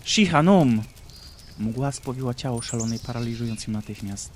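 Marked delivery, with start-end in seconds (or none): 0.0-0.8 much louder, higher paralysing spell; loud shout of the spell. Acted. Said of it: "Ashera krzyknęła".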